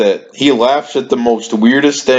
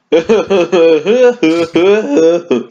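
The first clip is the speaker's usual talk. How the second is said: higher, drawn-out mock laugh; imitated teacher laugh; 7 semitones higher than usual. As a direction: imitate his laugh, pitched up. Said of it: "Here's how he laughs".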